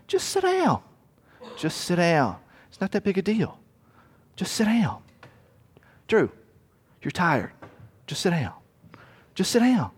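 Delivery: gently persuasive devil urging rest; soothing tempter. Acted. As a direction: lull the tired traveler into sitting. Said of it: "one of Satan's big things".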